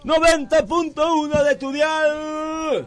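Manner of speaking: radio sports announcer. Acted. Parody radio host intro.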